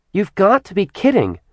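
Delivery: high, incredulous protest; disbelief. Acted. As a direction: throw it back in disbelief. Kat disbelieving Acheron.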